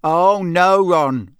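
loud, exaggerated mock reassurance; very sarcastic. A narrator's reading. Brother answers brother in chimney, sarcastic. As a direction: lean hard into the sarcasm. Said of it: "came Fred's voice very sarcastically".

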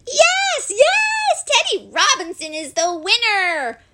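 excited cheering. A narrator's reading. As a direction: cheer like a whole party of children. Party guests all cheer the winner.

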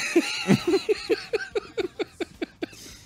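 long, high peal of laughter; real laughing fit. Real, not acted. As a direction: lose it completely while trying to resume. Cracking up again while resuming story.

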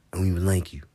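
whispered. Acted. Repeated line, whispered then loud.

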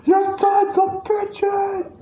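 high, slow cartoonish squawk; mock Penguin yell. Friend echoing the waddling Penguin's curse, high drawn-out mock yell. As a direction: stretch it out in a high imitated wail.

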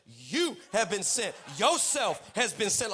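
slow retort with You and Yourself punched; indignant, slow correction stressing You and Yourself. Retold or imitated. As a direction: lean hard on You and Yourself, drawn out. Throws friend's words back.

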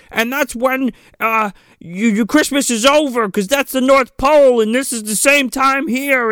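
high, goofy rambling voice; mock rambling hero. Hero explaining the North Pole time, high and goofy. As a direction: babble it like a clueless kid. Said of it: "he's like".

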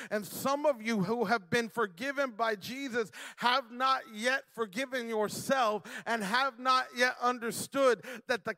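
high, loud preaching with shouted peaks; full-force preaching. Acted. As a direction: drive the message home to the congregation.

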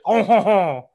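loud, high hammed-up French "oh-ho-ho"; mock French chortle. Host playing the smug French speaker, mock French chortle.

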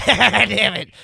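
ordinary talk

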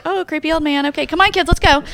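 high, lightly voiced wary parent; mock wary parent. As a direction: hurry the kids away from the stranger. Passersby dismissing him.

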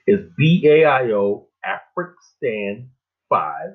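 slow and clear so listeners can write it down; slow dictation. Shown as a demonstration. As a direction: spell each letter out slowly. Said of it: "dictating the web address".

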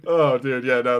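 usual speaking voice

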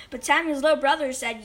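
ordinary delivery

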